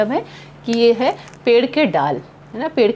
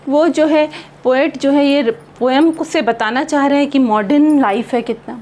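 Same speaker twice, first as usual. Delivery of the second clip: normal talking voice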